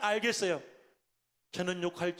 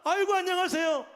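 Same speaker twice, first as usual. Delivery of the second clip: much higher, louder acted hello; sudden effusive greeting; 10 semitones higher, 6 dB louder than usual. Fuming pastor recognizing a church member's dad, suddenly effusive greeting. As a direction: switch to an over-friendly greeting, voice jumping up. Anger turns into greeting an acquaintance.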